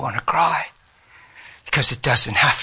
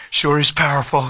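ordinary talk

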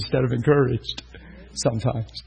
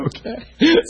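laughing okay; 10 semitones higher, 4 dB louder than usual. Real, not acted. Amused answer laughing.

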